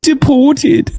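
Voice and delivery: high mock British "Deported."; British accent. Quoting the Brit who said "Deported.", mock British. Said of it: "My man said".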